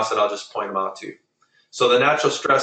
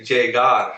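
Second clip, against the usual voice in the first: llegar said slowly, stressed on final GAR; end stress. Shown as a demonstration. Teacher prompting students to repeat llegar, slow, end-stressed on GAR. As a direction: lean on the last syllable of llegar, lle-GAR. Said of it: "putting the stress at the end".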